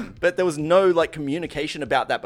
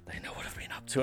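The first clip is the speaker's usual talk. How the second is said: hushed mortified aside, half whispered; embarrassed whispered thought; muttered to himself; 8 semitones lower, 15 dB quieter than usual. Retold or imitated. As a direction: mutter it under your breath, caught out.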